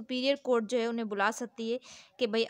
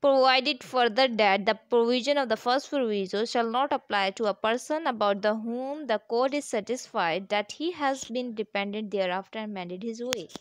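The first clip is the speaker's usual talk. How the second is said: slow, deliberate, louder reading of the law text; statute reading; 5 dB louder, much slower than usual. Acted. Teacher reading the law to students, slow formal reading. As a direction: read each clause out slowly, like dictating.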